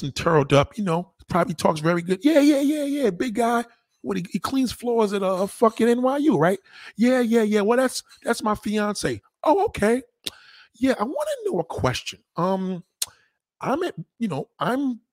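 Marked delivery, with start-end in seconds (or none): 2.2-9.2 high, sugary saleswoman; sweet-talking sales clerk voice. Retold or imitated. Voicing the saleswoman on phone. 9.4-15.0 high, polite lady voice; lady voice lifted high and prim; high-class lady caller. Retold or imitated. Voicing the rich woman caller.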